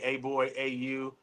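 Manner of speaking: slow, low, soft mock of a rude summons; rude call-out. Mimicking coworkers who summon him rudely, slow and soft. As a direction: lightly imitate someone barking at you. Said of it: "people saying".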